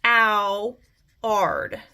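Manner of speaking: "k-ow-ard" with each sound pulled apart; split into sounds. Shown as a demonstration. Teacher to class, sounding out "k-ow-ard" slowly. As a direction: break "k-ow-ard" into its parts, stressing the ow. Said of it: "and so I hear".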